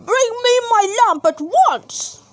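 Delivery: angry shout. A narrator's reading. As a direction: yell for the lamp in a rage. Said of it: "the magician began to shout".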